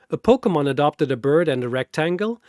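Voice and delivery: usual speaking voice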